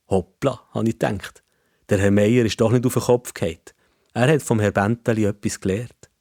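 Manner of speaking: ordinary delivery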